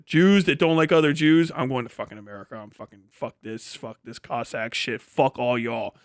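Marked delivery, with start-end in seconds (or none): 1.5-6.0 low, hushed grumbling in character; fed-up mutter. Retold or imitated. Fed-up emigrant cursing those left behind, low mutter. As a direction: imitate someone cursing everyone under their breath.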